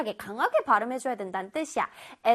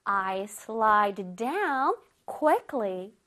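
slow example read; 4 semitones lower, much slower than usual. Shown as a demonstration. Teacher reading example to children, very slow. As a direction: read "I slide down quickly" very slowly. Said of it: "시작!".

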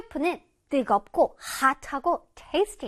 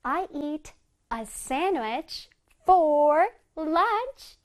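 very slow, words drawn out; slow model reading; much slower than usual. Shown as a demonstration. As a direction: read slowly for the class to copy. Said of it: "다시 시작".

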